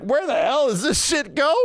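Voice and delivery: high squeaky confusion; baffled exasperation. Retold or imitated. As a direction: puzzle over the engine bay in disbelief. Staring at loose battery.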